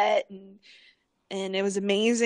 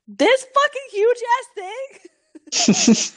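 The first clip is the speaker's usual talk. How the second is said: high, slow, half-laughing, half-crying; a scared laugh-cry at the top of the waterfall; laughing terror; 13 semitones higher, 5 dB louder, much slower than usual. Retold or imitated. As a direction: squeal at the huge drop in mock panic. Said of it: "And I was like".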